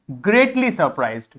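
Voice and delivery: usual speaking voice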